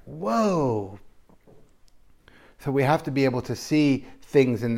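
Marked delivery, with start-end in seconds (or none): 0.0-1.0 drawn-out whoa. Retold or imitated. Spiralling into a daydream.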